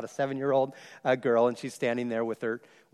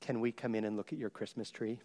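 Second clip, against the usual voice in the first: put-on much softer, shy little girl's ask; almost a whisper; 5 semitones lower, 12 dB quieter than usual. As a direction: hush it like a timid child. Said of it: "almost in a whisper".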